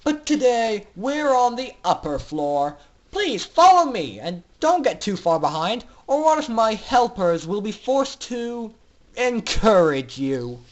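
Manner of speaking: mock the prisoners with cruel pleasure; sneering villain doctor. Doctor Atmosphere sneering at the captive foals, loud and cruel.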